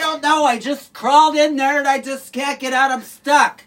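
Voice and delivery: loud and strained, high; calling out. Acted. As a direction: shout it out from under the sink. Calling out from under sink.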